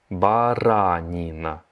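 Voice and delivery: баранина said slowly and clearly; slow teaching repeat. Shown as a demonstration. Teacher slowing баранина down for the class. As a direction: draw out баранина. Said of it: "slow repeat of vocabulary".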